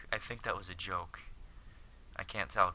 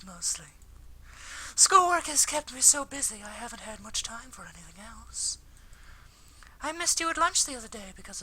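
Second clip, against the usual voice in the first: put-on much higher girlish voice; apologetic anime schoolgirl; 5 dB louder than usual. Akiko apologises to Hikaru for missing lunch, high schoolgirl voice.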